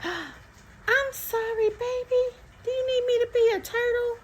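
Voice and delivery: put-on very high baby-talk to a child; cooing apology. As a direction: coo it high, with exaggerated concern. Mother apologizing playfully to daughter.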